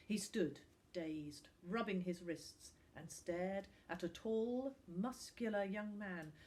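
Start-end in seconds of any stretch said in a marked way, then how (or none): none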